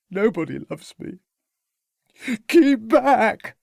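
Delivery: distraught youth crying out; desperate cry. A narrator's reading. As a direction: push them off in despair.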